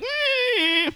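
long, very high whimper; pained tiger whine. Acted. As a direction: squeal like a gored tiger. Tiger reacts to being gored.

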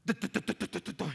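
mock stammer, a light tapping burst; lightly voiced stuttering child; imitating a stuttering boy. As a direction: copy the boy's stuck syllables, half-teasing. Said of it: "Un niño que apenas hablaba".

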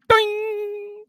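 very high snapped-string "ding"; high twang. Retold or imitated. Singer showing his vocal cord going, high ping. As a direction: imitate a string pinging, very high. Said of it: "it's just gone".

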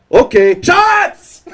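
high, loud, drawn-out party shout; mock drunk yell. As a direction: holler it slowly like an imitated drunk. Drunk woman suddenly calls for shots.

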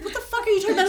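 a hint of a baffled friend; confused friend. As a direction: ask like a bewildered friend. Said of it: "my friend's like".

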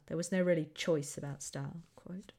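normal talking voice

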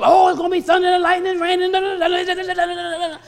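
loud, high mock panic running into babble; hammed-up hysterical forecast, dissolving into nonsense syllables; worked-up weatherman. Preacher mocking the TV weatherman's storm warning, loud and worked up. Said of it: "Get you all worked up".

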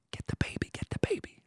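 hushed, covert whisper; acted stage whisper to a partner; urgent whisper. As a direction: whisper it as a secret instruction. Secret instruction to wife over stranger.

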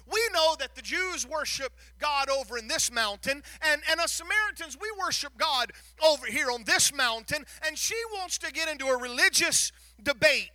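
loud, driving sermon voice; preaching. Acted. Preacher recounting the mountain debate to the church, forceful. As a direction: push the point with rising force.

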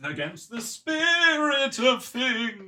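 very high, slow, and theatrical; pompous, stretched-out parody; mock pompous echo.

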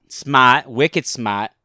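loud Boston "smaht"; Boston accent. Shown as a demonstration. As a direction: punch it out like a Bostonian. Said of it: "you can sound like you're from Boston".

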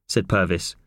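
usual speaking voice

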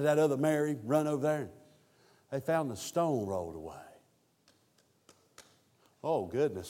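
ordinary talk

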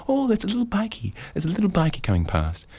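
high cooing over a little bikey; charmed pedestrian. Retold or imitated. Walkers turning round to a passing bike, cooing. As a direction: coo like spotting a puppy. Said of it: "they turn around and they go".

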